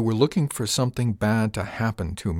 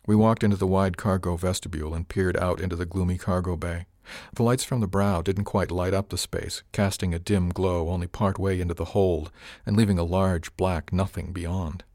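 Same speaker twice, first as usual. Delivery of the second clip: ordinary delivery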